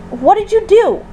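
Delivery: high, sharp accusing question; snapped. A narrator's reading. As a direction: snap at someone who messed up. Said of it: "Astrid snapped at Eret".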